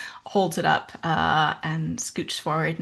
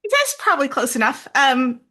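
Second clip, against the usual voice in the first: high, loud call across distance; 9 semitones higher, 6 dB louder, faster than usual. Acted. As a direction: shout it over to them.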